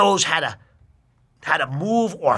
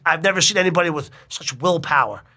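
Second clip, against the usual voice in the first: ordinary delivery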